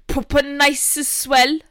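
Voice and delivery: slow, loud put-on accent; Panda Girl. Showing co-host their best accent, drawn out. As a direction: draw out the mock accent. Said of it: "I'll just do Panda Girl".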